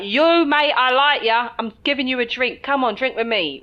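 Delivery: buddy up to a stranger after a few drinks; jovial mock drunk mate; tipsy English bloke. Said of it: "especially if you get some liquor in them, is like".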